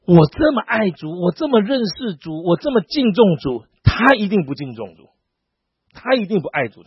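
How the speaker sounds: high, slow self-righteous voice; hammed-up pious boasting; smug believer. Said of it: "可是我们很多人说".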